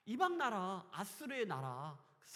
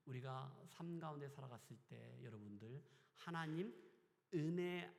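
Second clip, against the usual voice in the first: normal talking voice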